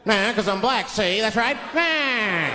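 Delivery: lightly voiced old-time tough guy; forties gangster. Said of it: "I'll talk crazy".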